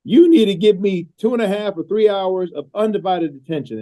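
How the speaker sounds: coach's exaggerated South Carolina accent laying down the law; terrible South Carolina accent. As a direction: put on a bad South Carolina accent like a folksy football coach. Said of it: "I'm gonna do my terrible South Carolina accent".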